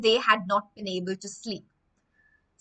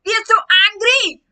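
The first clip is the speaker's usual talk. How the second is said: high, loud, fast furious yell; acted angry shout; 11 semitones higher, 11 dB louder, faster than usual. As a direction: shake your fist and shout. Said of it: "They were like".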